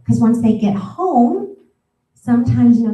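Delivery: normal talking voice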